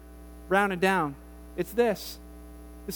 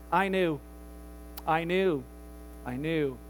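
1 timid confession; 7 semitones lower, 7 dB quieter, slower than usual. Acted. Fearful servant confessing.

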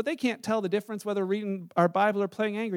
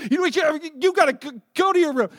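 mock outburst, higher and louder; upset child scolding; 6 semitones higher, 7 dB louder than usual. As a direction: imitate a cross kid bossing his little brother around. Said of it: "and he's upset".

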